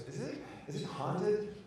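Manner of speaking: soft, slow, high timid question; imitating a scared friend. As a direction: hesitate fearfully over the question. Retold frightened friend asks about ghosts.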